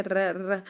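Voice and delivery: ر rolled and heavy; Arabic raa. Shown as a demonstration. Teacher drills the rolled ر. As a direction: roll ر full-mouthed. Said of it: "را کی آواز ہماری آنی چاہیے، جیسے".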